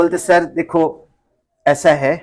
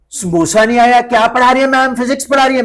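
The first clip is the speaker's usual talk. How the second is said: incredulous principal; 6 semitones higher, 4 dB louder than usual. Retold or imitated.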